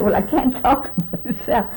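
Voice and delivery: laughing through the words. Real, not acted. Laughing while retelling her reply.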